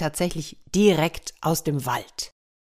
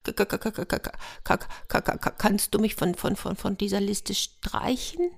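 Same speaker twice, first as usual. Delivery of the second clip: soft, frightened stutter of a little hare; a shaky hare stuttering in fear; trembling stammer; 4 semitones higher, 5 dB quieter than usual. A narrator's reading. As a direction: stammer every word, scared stiff. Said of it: "die zitterte schon sehr".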